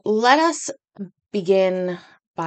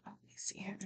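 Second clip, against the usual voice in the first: soft whisper while looking something up; whispered to self; 24 dB quieter, faster than usual. Real, not acted. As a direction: murmur it off-mic to yourself. Checking something privately.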